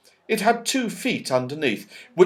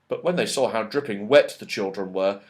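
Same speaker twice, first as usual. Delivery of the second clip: ordinary delivery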